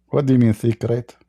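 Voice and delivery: ordinary talk, nothing marked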